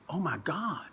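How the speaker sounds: whispered oh my God; stunned whisper. Retold or imitated. Manager spotting the sign, whispering in awe. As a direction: breathe it out in shock. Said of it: "J J sees it and goes".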